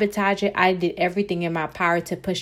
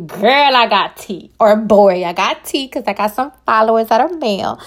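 louder, playful "I got tea"; excited gossip tease; 7 dB louder than usual. Real, not acted. Host teasing listeners about gossip, excitedly louder. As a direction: spill the news with a grin.

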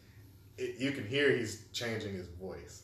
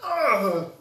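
loud, high groan of disgust; hammed-up disgusted groan; disgusted ugh; 12 semitones higher, 15 dB louder than usual. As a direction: let out a big disgusted groan. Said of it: "I was like".

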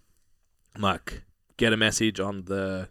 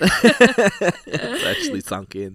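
laughing; 12 semitones higher, 7 dB louder, much slower than usual. Real, not acted. Reacting to the story.

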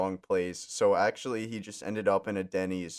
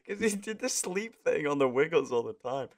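normal talking voice

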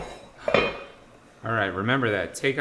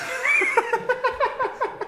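high peal of laughter; burst of laughter. Real, not acted. Cohost cracking up at the Italian plumber joke, high laughter. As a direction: laugh hard, high-pitched.

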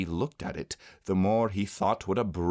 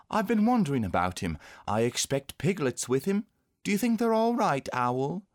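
put-on high-pitched child's voice; a boy wondering anxiously about his friends; concerned young boy; 8 semitones higher than usual. A narrator's reading.

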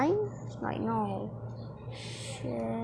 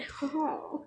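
short embarrassed laugh; a quick awkward chuckle; 5 semitones higher, 4 dB quieter than usual. Real, not acted. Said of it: "I did that laugh. It was so horrible".

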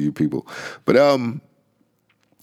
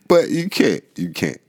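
ordinary delivery

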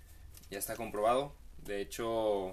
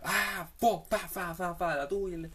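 hammed-up screaming fit of half-swallowed curses; mock Oscar-worthy yelling; 5 semitones higher than usual. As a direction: rant like an actor losing it in a car. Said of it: "empieza a gritar".

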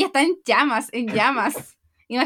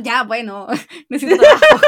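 laughing reluctant yes; 12 dB louder than usual. Retold or imitated. Reluctant waiter agrees, laughing.

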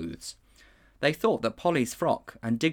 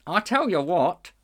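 higher, louder bright donkey; 8 semitones higher, 6 dB louder than usual. A narrator's reading. Donkey brightly offering an idea. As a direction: pipe up cheerfully as a donkey. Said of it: "said the donkey brightly".

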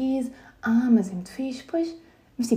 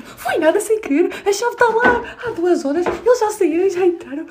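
high, louder mock-indignant protest; imitating Tomás; 12 semitones higher, 5 dB louder than usual. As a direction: play him outraged and stubborn. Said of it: "E o Tomás".

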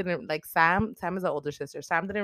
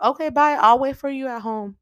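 much higher and louder breezy brush-off; mock cowardly exit; 7 semitones higher, 6 dB louder than usual. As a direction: chirp a quick, bright goodbye and bail. Her own imagined cowardly reply.

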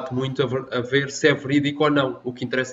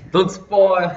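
playful warning; 7 semitones higher, 10 dB louder than usual. Real, not acted. Stops friend revealing the answer.

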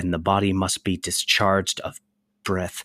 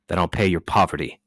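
ordinary delivery

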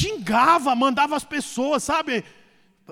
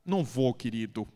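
gentle refusal; 12 semitones lower, 7 dB quieter than usual. Retold or imitated. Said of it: "Eu falei".

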